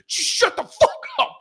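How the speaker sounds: high, strained mock yell; exasperated viewer. Viewer fed up with the hobbits, high exasperated yell. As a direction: burst out as if fed up.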